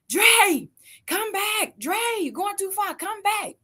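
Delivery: calling out. Retold or imitated. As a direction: shout after the boy from the porch. Dad calling son from afar.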